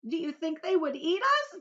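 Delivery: high, loud, trembling question; frightened earthworm. Acted. Earthworm fearfully asks in a high loud voice. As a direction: ask in fear as the worm.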